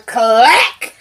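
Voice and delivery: voiced bottle smash, laughing; laughing sound effect; bottle clack through a laugh.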